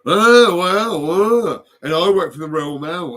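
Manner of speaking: high, loud pompous mimicry; mocking postman. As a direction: lord it over like a puffed-up postman. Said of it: "On my doorstep".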